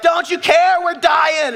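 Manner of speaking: high, loud, frantic yell; panicked Peter shout. Acted. Peter to sleeping Jesus in the storm, panicked shouting. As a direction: cry out as if drowning. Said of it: "Peter's like".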